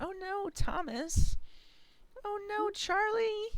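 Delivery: put-on very high, slow little-kid voice; high-pitched mock concern; child's dismay. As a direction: imitate a small child's drawn-out worry. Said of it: "She does it so good".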